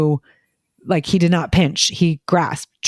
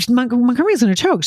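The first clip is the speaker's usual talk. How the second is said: higher, quicker, anxious warning; worried; 7 semitones higher, faster than usual. Retold or imitated. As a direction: retell the alarm, fast. Retold worry about choking.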